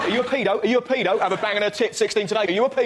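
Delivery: accusing tabloid. Retold or imitated. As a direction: badger them like a hectoring tabloid. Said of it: "The Sun".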